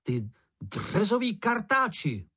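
high, slow, mock-furious name-calling; storybook taunting, drawn out and high; hollering insults. A narrator's reading. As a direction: hurl insults to provoke the monster. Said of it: "zahulákal Mumínek".

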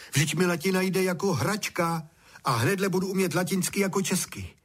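high, gleeful self-talk; overjoyed. A narrator's reading. Honzík jumps for joy at his easy Latin, overjoyed. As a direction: boast to yourself in a high, delighted voice. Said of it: "poskočil radostí a povídá sám sobě".

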